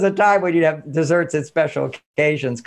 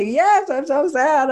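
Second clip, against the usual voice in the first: put-on much higher, slow whimpering child voice; mock sobbing child; 9 semitones higher, slower than usual. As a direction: whimper it like a sad little girl.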